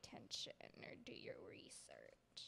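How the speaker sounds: soft unvoiced whisper, low; teasing whisper. Real, not acted. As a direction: needle your friend under your breath. Teasing jab at her co-host.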